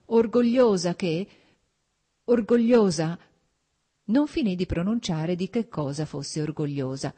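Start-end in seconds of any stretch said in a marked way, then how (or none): 0.1-3.2 higher, louder, slow, breaking into tears; words choked off by sobs. A narrator's reading. Anna tells Vronsky she is proud, voice breaking into tears. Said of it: "Lacrime di vergogna e di disperazione soffocarono la sua voce".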